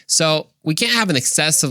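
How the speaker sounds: ordinary talk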